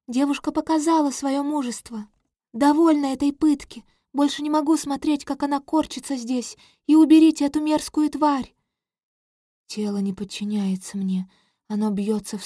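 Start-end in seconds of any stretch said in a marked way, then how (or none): 0.1-8.5 high, rising to a loud outburst; cartoon princess. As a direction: plead high, then shout in disgust. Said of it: "Слышу голос принцессы".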